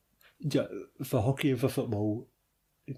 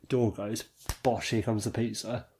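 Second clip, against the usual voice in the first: usual speaking voice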